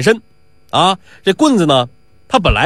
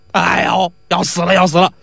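mock moaning. Faker moaning over his leg.

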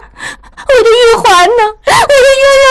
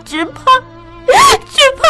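heartbroken sobbing. Acted. Daughter sobs heartbroken confession to her mother. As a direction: pour out the grief, breaking. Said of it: "痛入骨髓地说".

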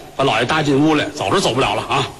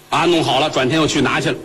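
plain reading voice

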